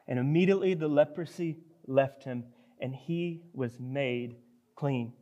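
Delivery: usual speaking voice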